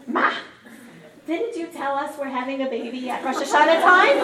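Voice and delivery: short calls building to a loud question; panicking kids. Retold or imitated. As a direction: imitate worried kids calling their mother. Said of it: "my kids were panicking".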